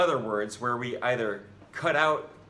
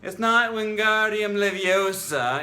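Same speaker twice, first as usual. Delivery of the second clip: high, loud, bossy schoolgirl correction of "Leviosa"; know-it-all correction; 8 semitones higher, 8 dB louder than usual. Retold or imitated. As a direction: scold like a prim classmate, stretching "Leviosa". Quoting the Harry Potter spell lesson.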